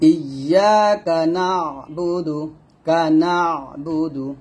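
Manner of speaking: নাবুদু with a squeezed throat sound after NA; slow recitation, doubled Y in ইয়াকা and pressed নাবুদু; pressed ayn. Shown as a demonstration. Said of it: "তাহলে বলতে হবে".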